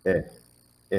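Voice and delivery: short soft "eh" sound; soft schwa eh. Shown as a demonstration. Teacher demonstrating "eh" to student. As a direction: say "eh" like a really soft E. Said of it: "think of it as a really soft E".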